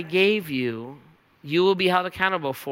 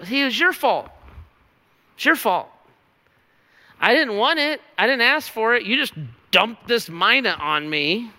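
defensive, pitched-up complaint, pushing the blame back; whiny blaming; 4 semitones higher than usual. Acted. Servant whines to his master that it's his fault, in a pitched-up complaining voice. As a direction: sulk and point the finger, voice pitched up.

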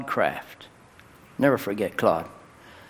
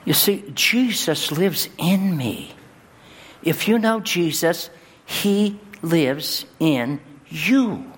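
high, slow, ringing preacher's cry; preaching at full force; 7 semitones higher, slower than usual. Acted. Pastor telling the congregation Jesus lives in them, in full preaching voice. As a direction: lean on each word and sing it out.

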